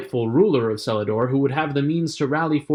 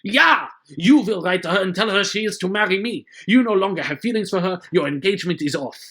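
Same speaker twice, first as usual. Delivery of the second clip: loud, high barked orders; furious villain command; 6 semitones higher, 3 dB louder than usual. Pretend. Villain ordering Marius to end his engagement, loud and furious. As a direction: force him to break it off.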